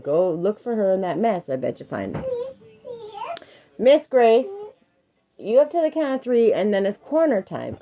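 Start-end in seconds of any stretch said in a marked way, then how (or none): 3.8-7.8 higher, firm parental warning; raised-voice parent laying down the law; stern warning. Real, not acted. Parent warns child of time-out.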